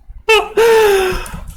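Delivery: high-pitched helpless laugh; real laughter. Real, not acted. Player cracking up over a brutal game, laughing. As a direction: burst out laughing mid-apology. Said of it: "I'm laughing".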